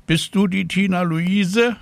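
high-pitched telephone voice; woman on the telephone. A narrator's reading. Hospital caller asking for Tina, high phone voice. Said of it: "hörte sie".

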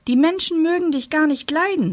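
high-pitched, teasing jeer; gloating. A narrator's reading. Spring taunts Winter about people's dislike, gloating. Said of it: "feixte er".

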